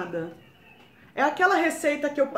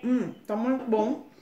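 talking while chewing food; muffled by mouthful of muffin; mouth full, chewing. Real, not acted. Seen 1: Woman holds muffin, cheeks full, jaw chewing while speaking.

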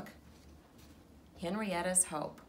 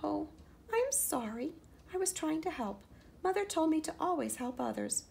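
high, small sorry mouse voice; apologetic little mouse; 9 semitones higher than usual. Acted. Little mouse apologizing to Henrietta, high and small. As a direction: apologize like a tiny hurt mouse.

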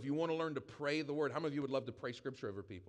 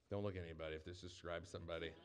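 wry aside; 8 semitones lower, 7 dB quieter, faster than usual. Real, not acted. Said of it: "aside after the reading".

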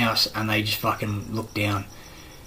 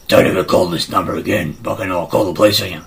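angry threat; 5 semitones lower, 6 dB louder than usual. Retold or imitated. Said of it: "then it's like".

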